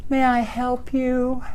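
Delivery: soft, slow and polite; a gently offered mock-polite question; nice Denver clerk. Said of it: "here in Denver, people are really nice".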